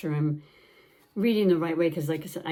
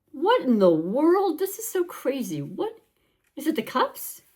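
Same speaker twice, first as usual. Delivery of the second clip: surprised; 7 semitones higher than usual. Real, not acted. Surprised by the card.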